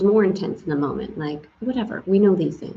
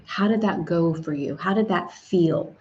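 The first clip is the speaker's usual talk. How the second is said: ordinary talk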